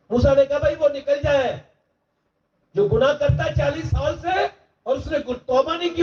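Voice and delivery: high, impassioned pleading sermon; preacher pleading with the young men; preaching at full force. Acted. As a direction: build the crowd toward repentance. Preacher building to a climax.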